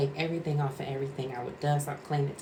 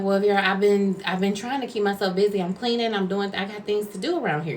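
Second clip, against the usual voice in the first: loud, higher-pitched retort; irritated; 5 semitones higher, 5 dB louder than usual. Retold or imitated. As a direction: retell an exasperated self-defense. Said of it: "so I'm irritated".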